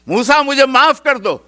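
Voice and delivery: high, loud begging cry; a drowning man's loud cry for forgiveness; desperate plea. Acted. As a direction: beg for mercy as the earth swallows you. Drowning Qarun begs forgiveness.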